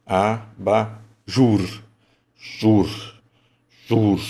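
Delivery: JUR said hardest, syllable by syllable; slow syllables with a strong JUR; stressed syllable demo. Shown as a demonstration. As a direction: split Abajur slowly, landing heavily on JUR. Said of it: "Essa é a mais forte".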